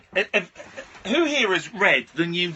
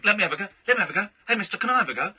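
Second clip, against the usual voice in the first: quick, higher mock-child pleading; rapid-fire begging in a lightly raised voice; pestering kids; 5 semitones higher, 3 dB louder, much faster than usual. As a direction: imitate kids clamouring for a turn, rattled off. Imitates swarming kids begging.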